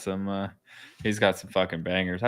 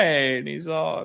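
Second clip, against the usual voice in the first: ordinary talk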